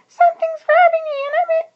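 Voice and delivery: very high, loud squeaky worm voice; Cheryl crying out. A narrator's reading. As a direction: squeal it like a grabbed little worm. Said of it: "she cried".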